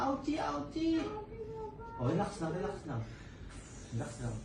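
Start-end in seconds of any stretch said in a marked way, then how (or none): 0.1-1.0 lightly voiced child in pain, higher; mock whimper of a hurting kid; pretend hurting child. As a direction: play the child saying it hurts, softly. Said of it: "Iyak ka".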